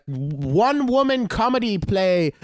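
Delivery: high, slow mock theatrical pitch; affected flyerer pitch. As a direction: imitate a pretentious show promoter. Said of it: "Can you talk like a normal person?".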